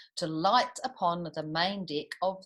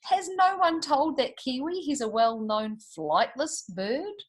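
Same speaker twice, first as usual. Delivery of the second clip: a hint of a pompous official, higher; indignant, with a high start; lightly voiced Speaker; 6 semitones higher than usual. A narrator's reading. Speaker scolds the flying kiwi, mildly indignant. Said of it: "and the Speaker said".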